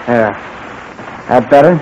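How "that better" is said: ordinary talk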